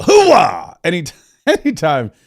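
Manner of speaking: loud Al Pacino hoo-ah yell; punchy Pacino yell. Retold or imitated. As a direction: bark it like Al Pacino. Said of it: "remind you of Al Pacino".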